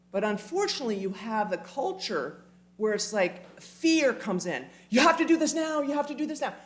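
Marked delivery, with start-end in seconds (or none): 4.9-6.5 urgent pushy demand. Retold or imitated. Mock pushy fear-driven demand.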